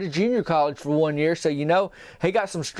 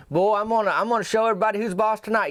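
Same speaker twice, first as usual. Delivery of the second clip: boastful brag; 6 semitones higher, faster than usual. Retold or imitated. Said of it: "just kinda talking how you talk before".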